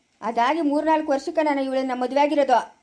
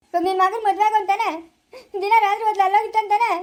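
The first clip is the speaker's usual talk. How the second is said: crying complaint; 5 semitones higher than usual. Acted. Husband crying to the elder about his son's marriage. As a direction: wail the grievance.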